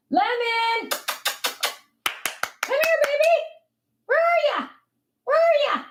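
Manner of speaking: very high sing-song call; high-pitched call to Lemon; calling the dog. Real, not acted.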